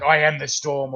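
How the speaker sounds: normal talking voice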